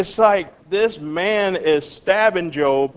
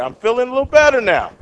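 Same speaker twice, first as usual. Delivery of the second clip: mock relief; 8 semitones higher, 4 dB louder, faster than usual. Voicing Job being comforted.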